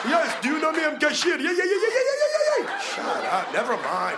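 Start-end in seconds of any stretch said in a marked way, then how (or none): 0.1-1.4 raised, eager mock clerk voice; mock clerk reply. Clerk proudly introducing himself, lightly voiced. 1.4-2.8 cackling eager yes-man; hammed-up clerk. Clerk agreeing to the customer, hammed-up and laughing.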